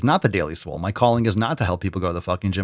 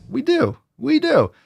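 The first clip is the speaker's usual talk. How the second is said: much higher, triumphant; excited insistence. Acted. Host crowing about the tapestries he sells. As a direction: crow it with glee.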